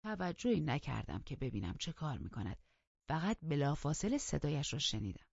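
ordinary delivery, nothing marked

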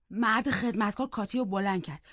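usual speaking voice

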